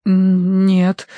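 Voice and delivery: mumbling. A narrator's reading. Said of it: "промямлил Терренс".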